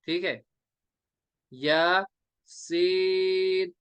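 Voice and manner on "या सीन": "या" brief, "सीन" long and level; madd recitation. Shown as a demonstration. Teacher repeating the model for students, drawn out. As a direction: stretch the ee of "सीन". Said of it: "ऐसे ही".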